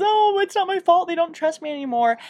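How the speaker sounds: high, pleading protest; whiny excuse. Retold or imitated. Judy protesting to Long Fang, high and whiny. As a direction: whine that it isn't her fault. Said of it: "she says".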